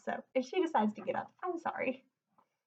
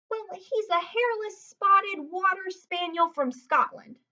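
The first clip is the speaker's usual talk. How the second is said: put-on higher, slow innocent kid; explaining boy; 8 semitones higher, 3 dB louder, slower than usual. A narrator's reading. Lewis covering for Alphonse, slow and innocent. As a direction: explain it with a straight face. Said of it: "explained Lewis".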